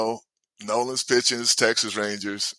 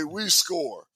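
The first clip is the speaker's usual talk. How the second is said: usual speaking voice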